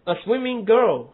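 put-on slow, swimming and girl both stressed, girl falling; both words stressed. Shown as a demonstration. Teacher showing students both words stressed. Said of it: "都重读".

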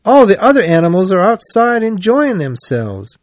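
very high, lively chiding; cheerful. A narrator's reading. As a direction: scold a friend cheerily in a high voice. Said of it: "said Rat cheerfully".

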